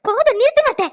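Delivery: high and loud, enough!; angry outburst. Acted. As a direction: cut her off in fury. Daughter-in-law snaps at mother-in-law. (கோபத்தில் கத்துதல்)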